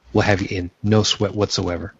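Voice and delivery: usual speaking voice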